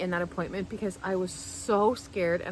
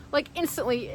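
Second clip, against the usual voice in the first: usual speaking voice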